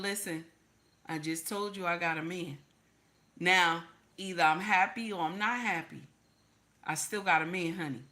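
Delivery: low, soft, composed rebuff; calm firm refusal. Acted. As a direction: quietly set a boundary without any nastiness. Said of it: "you don't have to get nasty and indignant".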